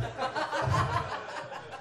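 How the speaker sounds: breathy high-pitched giggle. Real, not acted. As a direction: giggle helplessly. Laughs at own slip.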